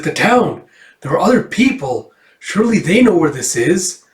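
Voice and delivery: urgent excitement. Acted. Lucy reacts to news of a town, excited.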